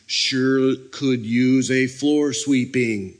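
pointed, drawn-out hint; dropping hints. Acted. Worker hinting at an idle helper, pointed and drawn out. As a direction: stretch each word out as an obvious hint. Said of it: "you drop the little hints".

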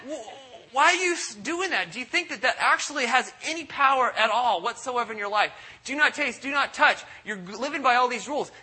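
exasperated scolding. Retold or imitated. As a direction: scold them in disbelief. Voicing Paul scolding Christians.

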